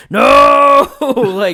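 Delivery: a high, loud, anguished scream of no; anguished yell. Retold or imitated. Player screaming no at the cliffhanger, anguished shout. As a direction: cry out in dismay at the cliffhanger.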